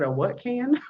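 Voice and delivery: high, rising incredulous echo; mock-shocked question climbing high at the end; retold bafflement. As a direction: act out not believing your ears. Retelling her baffled reaction.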